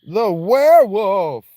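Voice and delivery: loud, high, drawn-out cry; melodramatic character voice. Acted. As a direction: holler it slowly as a hammed-up warning. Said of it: "Why do these people talk like this?".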